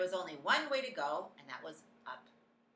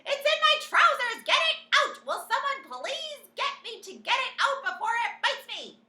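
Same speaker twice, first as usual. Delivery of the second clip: shrill high panicked shout; 14 dB louder than usual. A narrator's reading. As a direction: wail it in terror. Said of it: "the cook still shouting".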